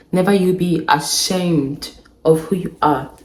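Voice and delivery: ordinary talk, nothing marked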